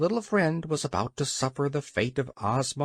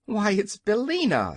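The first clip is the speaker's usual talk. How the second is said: high, surprised recognition; pleased surprise; 7 semitones higher, 4 dB louder than usual. A narrator's reading. Scarecrow recognises the cackling hen, surprised. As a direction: exclaim it higher, as a discovery.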